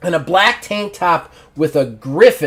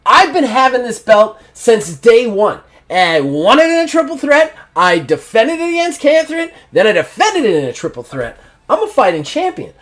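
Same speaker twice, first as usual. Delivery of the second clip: heated promo; 5 semitones higher than usual. Acted. Champion shouts down his critics in a heated promo. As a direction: build the promo up, pitch climbing.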